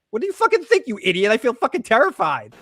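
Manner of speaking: high-pitched exasperated comeback; mock irate outburst. She answers Kenny's question about feelings, acted irate outburst. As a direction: snap back shrilly, playing up the indignation.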